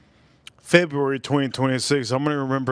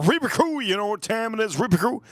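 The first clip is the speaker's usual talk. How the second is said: hyped crew call; 8 semitones higher than usual. Acted. Channel catchphrase call to viewers.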